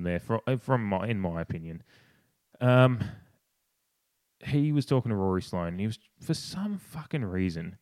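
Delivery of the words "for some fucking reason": normal talking voice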